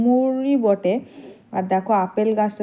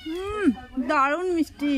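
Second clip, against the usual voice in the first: delighted tasting; 7 semitones higher, slower than usual. Real, not acted. Tasting a sweet plum.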